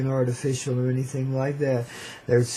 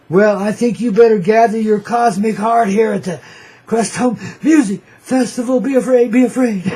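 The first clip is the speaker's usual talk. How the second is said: loud, higher hammed-up warning; mock doom warning; 6 semitones higher, 6 dB louder than usual. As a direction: ham up a spooky threat. Hyping festival with mock threat.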